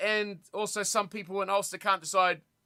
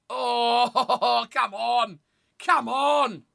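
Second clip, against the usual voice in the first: frustrated groan; 4 dB louder, much slower than usual. Real, not acted. Frustrated at bad play.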